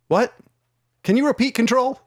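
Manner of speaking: high, puzzled radio reply; mock confused cop asking dispatch; officer on the radio.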